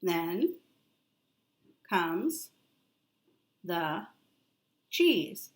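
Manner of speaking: each word alone, long pauses between; slow word-by-word reading for a child. Shown as a demonstration. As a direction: point and read one word at a time. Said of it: "You read it".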